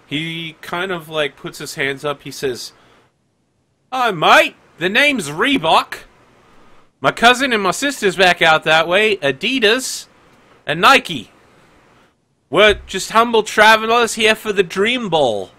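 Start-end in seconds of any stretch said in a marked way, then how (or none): 3.9-15.5 high, loud Aussie-tinged greeting; a bright stranger hailing the guards; cheery traveller. Acted. Traveller greeting security guards, high and loud.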